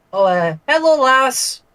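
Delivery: high-pitched character voice trial; young Grendel. Shown as a demonstration. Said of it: "how am I going to voice young Grendel".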